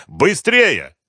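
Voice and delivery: loud, high hurry-up; commanding. A narrator's reading. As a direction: urge them on forcefully. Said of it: "приказал Малявка".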